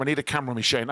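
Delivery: normal talking voice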